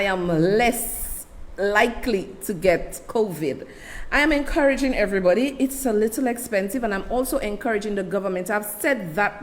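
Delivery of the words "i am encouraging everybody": usual speaking voice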